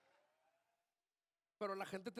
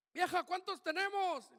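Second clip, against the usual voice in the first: higher, louder call across the house; calling to wife; 8 semitones higher, 8 dB louder than usual. Retold or imitated. Father calling out to his wife how many sons they have, loud. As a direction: shout the question to his wife. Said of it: "Uno, dos, tres, cuatro, cinco".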